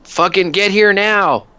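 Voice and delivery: high, slow, loud radio scream; officer yelling for backup over the radio. Acted. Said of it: "screams into his microphone".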